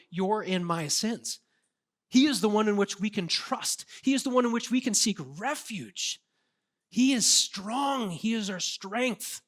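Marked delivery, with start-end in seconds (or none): 2.1-9.4 preaching crescendo. Acted. Preacher tells congregation Jesus is strong, rising emphatic proclamation. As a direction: build to a powerful declaration.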